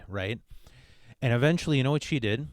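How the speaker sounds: normal talking voice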